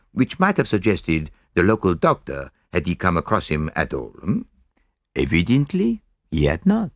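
put-on lightly accented detective, deliberate and explanatory; Poirot. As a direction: lay out the deduction step by step in a measured foreign-sounding voice. Poirot analyses the word list.

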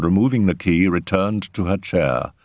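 plain reading voice

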